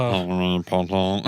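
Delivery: slow, drawn-out villain impression; slow mock-sinister character voice; Snape. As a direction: draw each word out like a sinister professor. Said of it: "That's fucking Snape".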